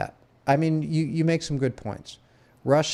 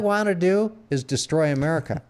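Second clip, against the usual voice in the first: mock fanatic; 6 semitones higher, 3 dB louder than usual. Mock fanatic speech about America.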